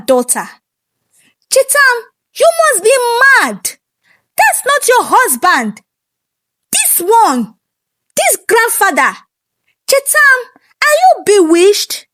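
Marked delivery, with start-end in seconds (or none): 1.5-12.1 outraged mother. Acted. Queen scolding her daughter for choosing an old man, high outraged cry. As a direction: shriek at a bewitched daughter.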